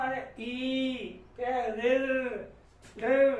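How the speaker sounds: ई and ऋ held long, stretched out slowly; drawn-out vowels. Shown as a demonstration. Teacher holding out the pluta vowels for the class. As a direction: stretch each vowel: ई, ऋ held three beats. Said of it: "वो थोड़ी देर तक सुनाई देगी".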